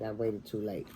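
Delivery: ordinary delivery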